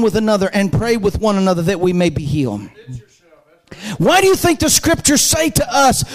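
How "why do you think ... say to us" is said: raised voice, stretched key phrases; urgent preaching. Real, not acted.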